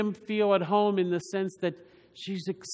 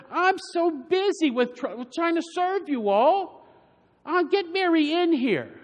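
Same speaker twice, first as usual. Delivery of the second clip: higher, mildly exasperated plea to the Lord; a hammed-up, put-upon hostess complaining; irritated Martha; 8 semitones higher than usual. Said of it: "doesn't that sound".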